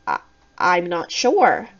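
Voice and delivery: slow, halting hesitation; stammering. A narrator's reading. Narrator unsure when the teacher asks, stammering. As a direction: trip on the first word, then go slowly. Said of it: "I stammered".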